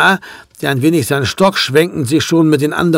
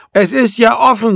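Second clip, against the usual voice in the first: mild call from inside, pitched higher; raised pitch, barely raised volume; 6 semitones higher than usual. A narrator's reading. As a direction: call out lightly through a door. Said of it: "rief es von innen".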